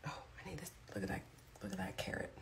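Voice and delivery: soft unvoiced whisper. Real, not acted. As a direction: whisper to yourself. Aside while eating.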